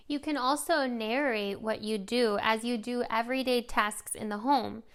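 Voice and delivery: normal talking voice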